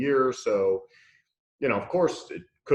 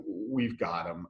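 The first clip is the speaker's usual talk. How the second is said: soft, lower imitation of a confiding whisper; retold whisper; 4 semitones lower, 6 dB quieter, slower than usual. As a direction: pass it on under your breath, like the friend did. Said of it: "leaned over and whispered to me".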